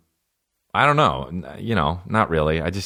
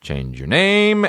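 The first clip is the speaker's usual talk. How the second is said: high, slow, demanding; mock demand; 10 semitones higher, much slower than usual. Real, not acted. Comic telling the listener to change the name, high and slow. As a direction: stretch it out as a mock order.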